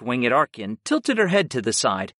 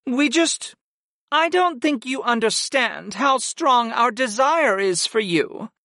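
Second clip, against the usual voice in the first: higher-pitched, earnest goddess; Sarah, yearning; 7 semitones higher than usual. A narrator's reading. Servant confessing desire to her master, yearning. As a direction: confess longing in a high character voice, then settle.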